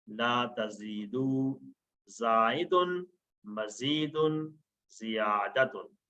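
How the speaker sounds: usual speaking voice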